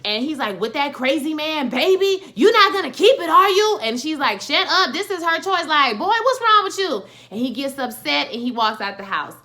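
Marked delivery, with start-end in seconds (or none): 0.6-3.8 high, loud and disbelieving; incredulous yelling. Retold or imitated. Kevin yells at pregnant Nina. 4.4-7.0 high, loud telling-off; angry comeback. Retold or imitated. Keisha snaps back at Kevin.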